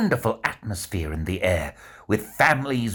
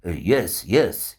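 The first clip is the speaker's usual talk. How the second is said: ordinary delivery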